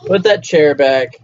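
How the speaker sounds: ordinary delivery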